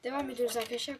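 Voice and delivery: normal talking voice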